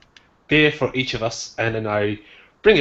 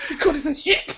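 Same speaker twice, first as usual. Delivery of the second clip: much higher, fast, giggling through it; laughing; much faster than usual. Real, not acted.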